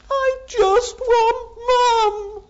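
put-on high, loud, slow wail of a little girl; sobbing child. Little girl wailing to the carer for her mum. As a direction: cry it out like a heartbroken child.

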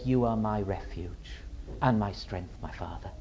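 low, soft prayer fading away; hushed devotion. Acted. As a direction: murmur it submissively to a father. Suffering Son answers his Father.

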